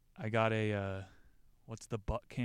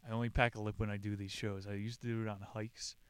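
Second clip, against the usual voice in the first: ordinary delivery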